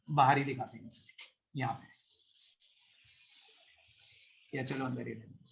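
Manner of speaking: low, very soft aside; talking to oneself. Real, not acted. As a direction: think aloud under your breath while working. Thinking aloud while drawing structure. (धीमी आवाज़ में खुद से बात)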